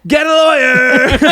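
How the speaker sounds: high, loud, drawn-out yell; daring shout. Acted. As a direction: yell the dare at her, stretched out. Shouted dare to absent sister.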